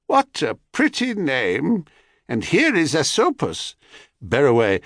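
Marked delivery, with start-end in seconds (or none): 0.1-3.7 put-on fawning old hag; old crone voice. A narrator's reading. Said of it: "the old woman".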